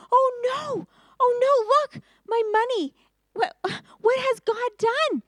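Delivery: high, loud panicked cry; panicked brother. Acted. Brother finds his money in his sack, panicking aloud. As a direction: gasp and cry out in alarm, pitched high.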